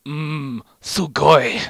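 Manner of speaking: chewing while talking; mouth full. A narrator's reading. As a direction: mumble through a mouthful. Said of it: "with his mouth full".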